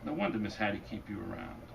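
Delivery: normal talking voice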